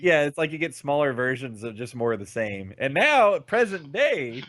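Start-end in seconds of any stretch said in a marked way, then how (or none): 2.8-4.4 higher, louder, then slow "present day"; dramatic build-up. Acted. Telling a friend about a gaming meme, dramatic reveal. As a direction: announce it like a big reveal.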